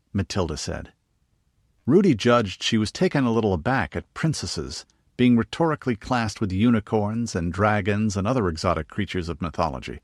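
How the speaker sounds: ordinary delivery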